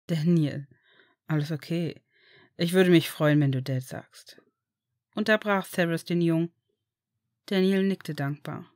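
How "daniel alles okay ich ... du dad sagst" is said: gentle father. Acted. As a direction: promise him he is safe now. (tiefer, ruhiger, beruhigender Vater)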